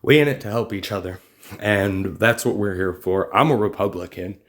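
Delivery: normal talking voice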